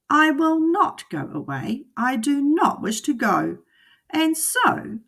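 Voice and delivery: high, loud cheeky cat; the cat refusing to leave, teasing; cat in the hat. Acted. The cat answering the fish, high and cheeky.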